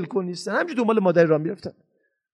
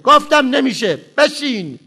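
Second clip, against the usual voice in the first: sharp, louder, higher order to sit; angry shout; 5 semitones higher, 6 dB louder, slower than usual. Retold or imitated. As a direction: snap at the child in anger. (داد زدن با عصبانیت)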